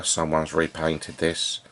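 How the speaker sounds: ordinary talk, nothing marked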